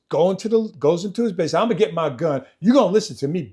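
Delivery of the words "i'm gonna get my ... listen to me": loud, higher retold threat; angry threat, raised voice. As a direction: imitate a drunk father raising hell. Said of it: "very loud".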